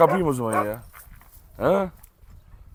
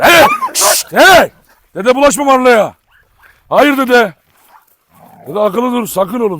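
stern shouting; 8 semitones higher, 10 dB louder, slower than usual. Real, not acted. Stopping grandpa dog attacking Marla.

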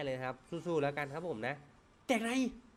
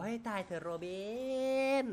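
handsome voice; 8 semitones higher than usual. Acted. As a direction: croon it like a leading man. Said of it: "อันนั้นเสียงหล่อ".

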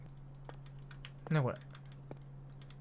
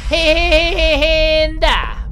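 sing-song taunt; 5 semitones higher, 8 dB louder, slower than usual. Real, not acted. Taunting the ghost.

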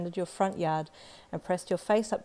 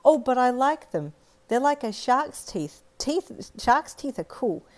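put-on high, louder, slower proud little girl; proud child; 6 semitones higher, 6 dB louder, slower than usual. Little girl tells the boy his shark teeth are cool, proud high voice. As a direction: declare it proudly like a kid showing off. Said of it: "you said proudly".

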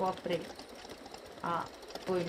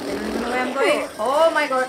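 rising panic, louder and higher; panicked outburst; 4 semitones higher, 6 dB louder, faster than usual. Real, not acted. Gamer panicking at the wheel, fast and loud. Player panics while driving.

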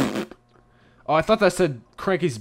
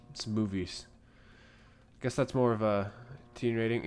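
usual speaking voice

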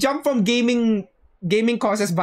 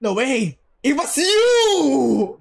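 slow, louder, higher mock-villain reveal; dramatic accusation; 4 semitones higher, 3 dB louder, much slower than usual. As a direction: drag it out like a soap-opera twist.

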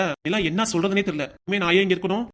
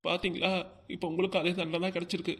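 put-on sad child; 5 dB quieter than usual. Child's sad words to father.

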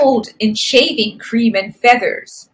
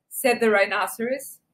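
usual speaking voice

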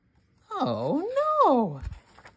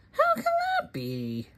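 much higher, soft puzzled squeak; squeaky puzzled voice; 6 dB quieter than usual. Acted. Reader to child, high soft puzzled squeak. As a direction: squeak it out in wonder.

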